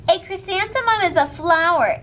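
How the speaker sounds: high, smug put-down; mock her name in front of the class; snooty. Victoria mocking in class.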